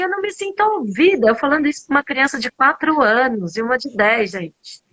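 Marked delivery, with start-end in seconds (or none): 0.0-1.3 high, fast mock yell at contained volume; retold yelling. Mother telling small children she feels unheard, retold shout. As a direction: re-enact a rushed, high-pitched outburst. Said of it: "no meio da gritaria".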